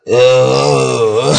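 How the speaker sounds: loud, drawn-out froggy croak; croaked sung "oh". Shown as a demonstration. Joking about singing to God with a froggy voice, loud croak. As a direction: groan out a tuneless, croaky note. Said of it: "Just croak to him".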